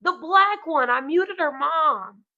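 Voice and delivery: put-on high, loud, slow child explaining; a kid matter-of-factly naming who she muted; little girl's voice. Little girl telling the mom who she muted, high and slow. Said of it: "And she's like".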